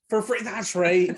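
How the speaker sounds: high, loud and drawn-out; mock-delighted, stretched delivery; hammed-up film quote. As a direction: play up the offer, slow and high. Acting out the film's free offer.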